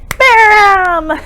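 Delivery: slow, loud, punchy rhyme line ending on a big bam; really loud bam. Shown as a demonstration. Teacher modelling a loud bam for the class. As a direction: belt out the bam for the kids. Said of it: "try to say bam really, really loud".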